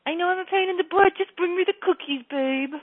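high, teasing sweet plea; light, playful. Shown as a demonstration. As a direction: own up cheerfully and coax him. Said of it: "keep it lighter".

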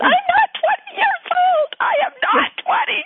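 shrieking, very high and loud; outraged daughter. Retold or imitated. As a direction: squeal it like an indignant teen. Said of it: "daughter's outraged reply".

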